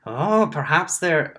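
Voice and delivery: lightly voiced Warforged in a botched accent; missed accent attempt. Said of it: "the Warforged says".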